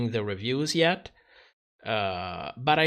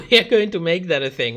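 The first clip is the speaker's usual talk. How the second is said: laughing repeat; 3 semitones higher, 7 dB louder, faster than usual. Real, not acted. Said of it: "repeat, laughing and fast".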